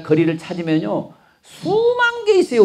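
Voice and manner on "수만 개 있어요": exclaimed. Real, not acted. Exclaiming the number of complaints.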